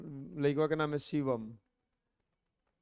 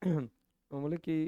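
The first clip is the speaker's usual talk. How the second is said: ordinary talk, nothing marked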